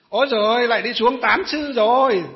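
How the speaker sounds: loud mocking jeer; mocking sneer. Village woman mocking pious grannies, loud mock sneer. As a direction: jeer at the old women like a gossip. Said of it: "Kiểu thế. Trêu như thế".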